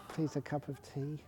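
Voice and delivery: soft, meek plea with a laugh; plea through a laugh. Retold or imitated. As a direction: ask timidly, half laughing. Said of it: "meek tea request".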